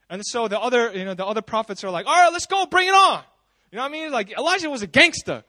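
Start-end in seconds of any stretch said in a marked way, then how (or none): 2.1-3.2 loud, high acted taunt; hammed-up "bring it on" bravado; cocky battle cry. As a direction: take up the dare at full voice. Retold prophets accept the challenge.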